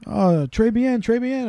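a hint of a pleased French owner, airy and high. Bar owner praising the singer, a light mock French voice.

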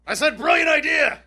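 shouted high and loud to a deaf man; shouting. Acted. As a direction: yell it so he hears. My hearing aid hasn't been working.

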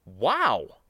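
mildly surprised exclamation. A narrator's reading. Younger spacer hearing old history, surprised. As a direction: exclaim with a touch of amazement. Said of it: "clearly surprised".

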